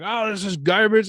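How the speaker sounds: high, slowed, scornful parent complaint; a lightly voiced angry parent; mock snapping parent. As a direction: sneer it like an annoyed hockey parent. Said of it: "the parents were just snapping".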